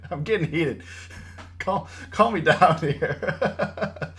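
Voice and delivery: real laughter breaking through the words; laughing. Real, not acted. Trader laughing at himself for getting heated, laughing. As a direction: crack up at your own outburst.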